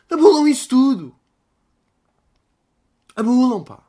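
"Abulam" bellowed high and loud; hammed-up protest cry; mock rally shout. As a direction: yell it like a protest chant.

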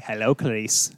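low, soft sinister greeting; creepy Hannibal Lecter voice. Retold or imitated. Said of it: "Silence of the Lambs".

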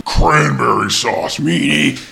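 mock turkey villain. Turkey taunting the girl it ambushed, hammed-up villain voice. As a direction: lean on cranberry sauce with gloating menace. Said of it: "He's like".

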